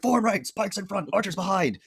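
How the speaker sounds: slow, loud, high-pitched commander's shout; Gothmog. Retold or imitated. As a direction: bellow drawn-out orders to the ranks. Acts Gothmog shouting battle orders.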